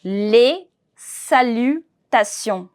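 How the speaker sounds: Les salutations said slowly and clearly for repeating; slow demo. Shown as a demonstration. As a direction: say Les salutations slowly so they can copy. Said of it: "repeat after me".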